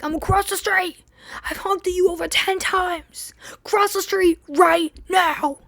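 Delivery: retold angry driver. Taxi driver yelling across the street, mock angry voice. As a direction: imitate the pushy driver barking at her. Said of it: "he starts yelling at me".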